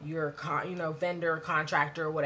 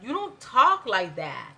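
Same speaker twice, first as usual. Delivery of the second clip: ordinary talk, nothing marked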